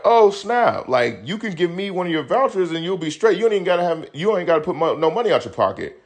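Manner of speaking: "Oh, snap" high and eager; excited. Retold or imitated. Telling the broke friend he can use his voucher, high and excited. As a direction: pitch it up like you just found a fix.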